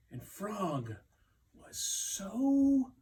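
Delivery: ordinary delivery, nothing marked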